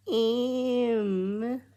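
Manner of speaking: very high, hammed-up Scouse "Em"; terrible Scouse accent. Mimicking a typical Scouser for viewers, in a badly faked Scouse accent. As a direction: squeak out a bad Liverpool "Em". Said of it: "That was terrible again".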